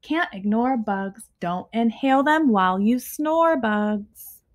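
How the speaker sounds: ordinary delivery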